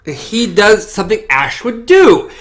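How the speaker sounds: ordinary talk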